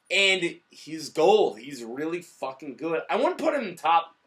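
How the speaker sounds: normal talking voice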